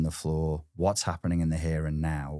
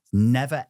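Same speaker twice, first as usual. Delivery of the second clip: never punched high and loud; stress on never; 5 semitones higher, 5 dB louder than usual. Real, not acted. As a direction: hit never hard, drawn out. Emphasis on never again.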